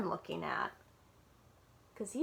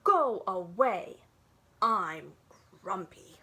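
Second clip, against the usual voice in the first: grumpy voice; 4 semitones lower, 5 dB louder, slower than usual. Shown as a demonstration. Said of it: "make a grumpy face when you say those words".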